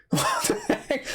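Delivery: squeaky, very high mock outrage; indignant disbelief. Player protesting the ref's handball whistle, high mock disbelief. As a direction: retell being called for handball, incredulous. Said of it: "I'm like".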